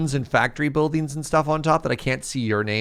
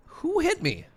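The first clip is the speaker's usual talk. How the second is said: Who hit me squeaked much higher; squeaky indignant question; slower than usual. Real, not acted.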